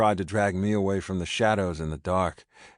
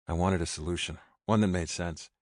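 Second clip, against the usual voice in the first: usual speaking voice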